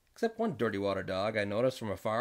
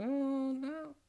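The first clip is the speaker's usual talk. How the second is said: high voice; 11 semitones higher than usual. Retold or imitated. The husband answers the newcomer in a raised high voice. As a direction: voice pitched well up, light and quick. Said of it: "And I said".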